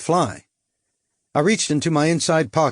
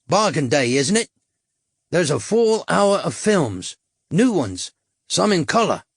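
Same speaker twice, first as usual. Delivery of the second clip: higher, wheedling London hustler voice; cockney tout; 5 semitones higher than usual. A narrator's reading. Tout selling blue films to the Yank, wheedling cockney. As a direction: reel off the menu like a market trader.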